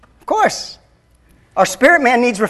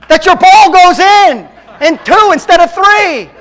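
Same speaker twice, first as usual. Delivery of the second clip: higher and louder, hammed-up sabbath excuse; mock pious golfer; 8 semitones higher, 7 dB louder than usual. Pastor jokes about golf on the Sabbath, hammed-up. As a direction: play the golfer who twists the Sabbath rule, tongue in cheek.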